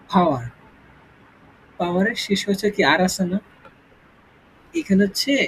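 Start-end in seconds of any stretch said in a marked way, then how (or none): 0.1-0.4 "পাওয়ার" starting with a puff, like phaoa; aspirated P. Shown as a demonstration. Teacher shows P as ph, "পাওয়ার" with an aspirated start. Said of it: "পাওয়ার পি, ফ".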